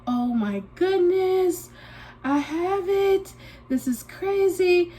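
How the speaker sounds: high, slow worried gasp; retelling her own panic at a cough; panicked. Retold or imitated. She panics to herself that she has it. Panicked self-quote about having Covid.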